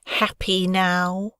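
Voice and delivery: slow, sneering 'Happy now?'; defeated bully sneering at the girl; twisted smile. Acted. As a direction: sneer it through a crooked grin. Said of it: "with a twisted smile".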